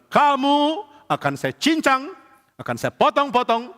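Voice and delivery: slow, high, drawn-out giant threat; menacing giant. Acted. Goliath threatens to chop David up, slow menacing giant voice. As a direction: draw out every threat to scare him.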